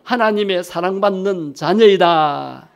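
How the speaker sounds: slow, drawn-out declaration; gemeinsames Bekenntnis. Shown as a demonstration. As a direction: lead the congregation in a confession, stretching the ending. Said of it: "한번 고백합시다".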